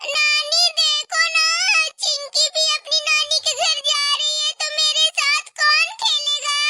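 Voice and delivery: put-on high, pleading little girl; a child voicing her hurt to grandma; whiny child voice. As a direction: whine like a sulky kid to nani.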